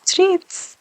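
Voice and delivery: high squeaky treats; excited squeak. Acted. Dog hearing its treats, excited squeak. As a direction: squeak it like an eager pup.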